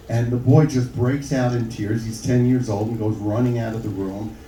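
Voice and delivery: normal talking voice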